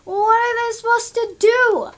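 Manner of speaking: loud, high exasperated cry; incredulous loud question. Retold or imitated. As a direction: throw up your hands, loud and high. Exasperated quote of herself.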